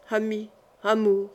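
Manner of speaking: understated despair, the two words set apart; distraught exclamation. A narrator's reading. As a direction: voice a restrained, narrated lament. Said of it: "s'écria Henri éperdu". (plainte éperdue mais retenue)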